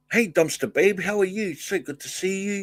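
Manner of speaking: light up as you welcome her; excited greeting. Real, not acted. Greets a viewer in the chat.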